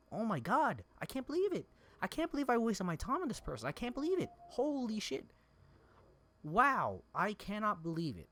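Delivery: high-pitched exasperated outburst; angry disbelief. Real, not acted. Streamer realizing time was wasted on this person, angry disbelief. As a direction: reel in angry, incredulous disbelief. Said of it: "I'm so angry about it".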